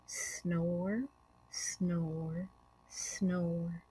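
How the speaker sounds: low, soft snoring; drowsy snore said gently; soft sleepy snore words. A narrator's reading. Story reader softly voicing sleeping groundhogs.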